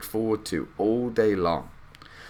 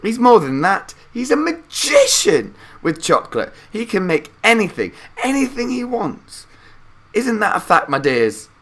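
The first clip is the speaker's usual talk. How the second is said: put-on high, loud, gushing old man's voice; Grandpa Joe excited; 13 semitones higher, 7 dB louder than usual. A narrator's reading. Grandpa Joe telling Charlie Wonka can make anything, high excited old man. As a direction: boast like an old man who's thrilled. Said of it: "cried the old man".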